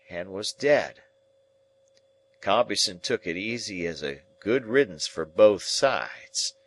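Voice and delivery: ordinary delivery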